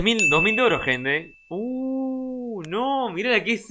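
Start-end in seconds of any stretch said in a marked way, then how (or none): 1.5-2.6 long, high, soft "ooh"; drawn-out soft coo of admiration; impressed ooh. Real, not acted. Streamer reacting to a skin in the shop, impressed ooh.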